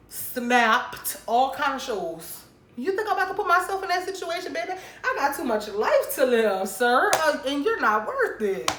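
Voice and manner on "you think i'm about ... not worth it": higher, fast, laughing sass; sassy dismissal. Real, not acted. Telling the boyfriend off in front of viewers, sassy. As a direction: brush him off with attitude.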